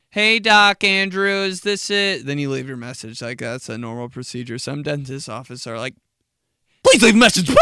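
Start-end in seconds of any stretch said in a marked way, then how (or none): 0.1-2.3 awkward loud greeting. Retold or imitated. Nervous kid on voicemail. 6.8-7.6 loud, high rushed prompt; quick prompt. Retold or imitated.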